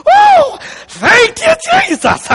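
shrill, very high and loud joyful cry; hammed-up shouting thanks to Jesus; ecstatic praise. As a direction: imitate a woman overjoyed after a crash. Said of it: "she came up going".

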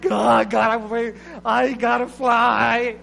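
eagle shouting. Retold or imitated. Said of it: "It's like".

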